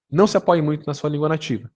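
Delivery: usual speaking voice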